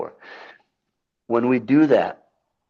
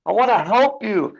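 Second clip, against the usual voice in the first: eager imitation; 10 semitones higher than usual. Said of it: "You're like".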